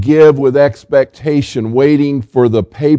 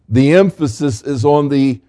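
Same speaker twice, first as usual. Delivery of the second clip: usual speaking voice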